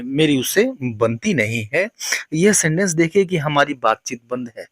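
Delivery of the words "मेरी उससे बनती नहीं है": normal talking voice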